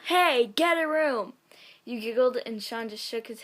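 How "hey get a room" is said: a louder, higher "Hey, get a room!" yelled slowly; mild mock yell. A stranger yells at the kissing couple, a mock yell. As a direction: call it out across the room. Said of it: "deep, loud voice yell".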